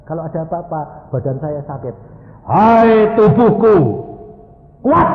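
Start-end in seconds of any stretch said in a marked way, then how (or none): 2.5-5.1 loud, slow, raised command; commanding call. Shown as a demonstration. Preacher orders his own sick body to be strong, commanding shout. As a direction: order it firmly, drawing out the first words.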